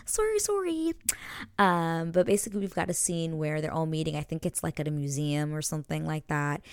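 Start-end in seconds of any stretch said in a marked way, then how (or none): 0.1-0.9 high, light repeated apology; giggly apology. Real, not acted. Host apologising to listeners after giggling, high and playful.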